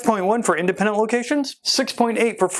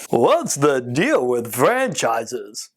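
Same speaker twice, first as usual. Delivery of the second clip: slow, exaggerated swooping stand-up comic impression; Jerry Seinfeld; 5 semitones lower, much slower than usual. Host opening the show on franchises with a joke, hammed-up Jerry Seinfeld impression. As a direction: stretch out each stressed word like an observational comedian. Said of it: "That's my best Jerry Seinfeld".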